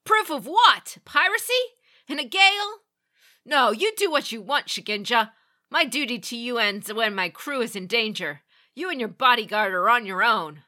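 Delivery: loud, high scornful retort; disdainful captain. A narrator's reading. Captain scornfully refusing Meiko and leaving her. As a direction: sneer it at her dismissively. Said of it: "He snorted at her in disdain".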